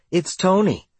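object, it's obviously fine; protesting. A narrator's reading. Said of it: "she protested".

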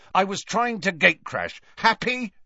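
high, indignant retort; Malfoy, angry. A narrator's reading. Caught boy confesses gatecrashing to his captor, high and angry. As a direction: throw the confession back angrily. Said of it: "he said angrily".

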